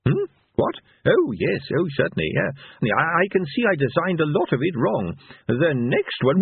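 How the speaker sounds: Leonard. A narrator's reading. Inventor musing aloud on his flawed design, distracted. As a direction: come out of a daydream and ramble.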